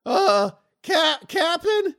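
high startled elf calling the captain; nervous high voice. Acted. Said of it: "he's like".

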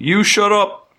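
high, loud guard snapping at a prisoner; angry guard. Acted. Guard silencing the bard, high and loud. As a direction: shout the prisoner down. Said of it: "the guard".

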